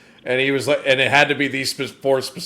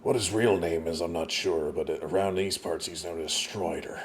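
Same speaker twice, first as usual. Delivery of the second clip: low, soft West Country accent; 6 semitones lower, 10 dB quieter than usual. Shown as a demonstration. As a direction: murmur it low in rustic West Country English. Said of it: "That West Country accent".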